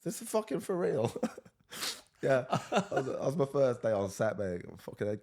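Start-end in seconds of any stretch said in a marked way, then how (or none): 0.1-1.1 stunned disbelief. Retold or imitated.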